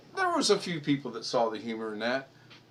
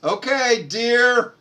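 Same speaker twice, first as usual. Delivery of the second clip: loud, higher, calling across the room; husband calling to his wife, loud and higher; calling sweetly; 11 semitones higher, 12 dB louder, much slower than usual. Real, not acted. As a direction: call out innocently to her. Answering wife from afar.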